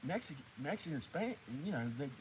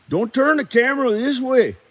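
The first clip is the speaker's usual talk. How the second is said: high, loud homeboy protest; raised voice, Mexican character; Mexican guy character; 9 semitones higher, 20 dB louder than usual. Acted. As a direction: warn them off in a put-on Mexican accent. Character orders camera away in skit.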